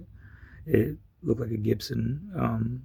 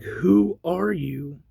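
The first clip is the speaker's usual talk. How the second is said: drawn-out, higher-pitched retold challenge; incredulous demand; 6 semitones higher, much slower than usual. Man refusing stranger's order to leave car, incredulous. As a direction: stretch it out slowly in disbelief.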